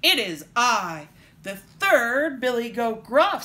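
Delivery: slow, booming biggest goat; big loud voice. A narrator's reading. Third goat facing the troll, big and loud. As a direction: announce yourself slowly and loudly. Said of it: "as big and loud as the troll's".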